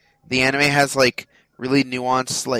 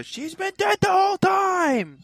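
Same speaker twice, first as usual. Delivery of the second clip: high, drawn-out mock wail; mock outraged shout. Acting out someone crying spoiler to friends, mock outraged. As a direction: yell it in exaggerated outrage, stretching it out.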